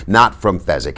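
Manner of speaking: ordinary delivery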